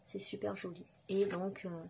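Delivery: usual speaking voice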